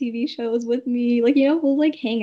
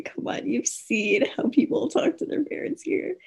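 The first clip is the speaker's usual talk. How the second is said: whisper. Real, not acted. Aside about how people talk.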